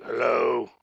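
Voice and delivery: mock deep-voiced phone hello. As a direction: answer with a put-on deep voice. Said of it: "had a deeper voice than both of us".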